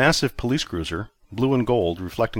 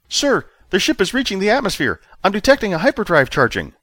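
put-on much higher, louder young officer voice; officer reporting to his commander, high and tense; nervous junior officer; 12 semitones higher, 5 dB louder than usual. A narrator's reading. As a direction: report urgently like a rattled subordinate.

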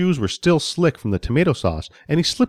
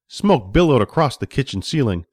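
usual speaking voice

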